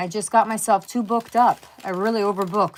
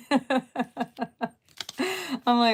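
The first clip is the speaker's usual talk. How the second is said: ordinary talk, nothing marked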